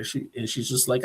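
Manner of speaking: ordinary talk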